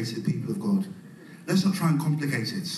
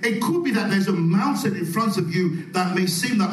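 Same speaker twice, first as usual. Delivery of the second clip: ordinary delivery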